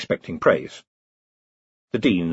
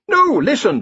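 a higher, louder objection; protesting; 5 dB louder than usual. Acted. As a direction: push back insistently. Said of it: "the Dean protested".

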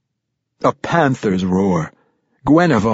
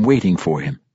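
plain reading voice

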